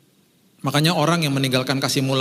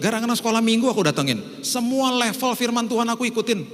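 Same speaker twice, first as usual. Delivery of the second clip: much higher mock eager believer; mock churchgoer; 9 semitones higher than usual. Preacher acting out an eager churchgoer, high-pitched. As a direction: play the overzealous church hopper. Overzealous believer lists schedule.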